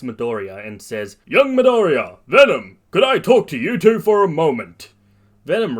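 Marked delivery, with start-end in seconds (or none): 1.2-4.9 big, high, very loud heroic hail; booming hero. Acted. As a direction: call out like a grand superhero. All Might hails student in hero voice.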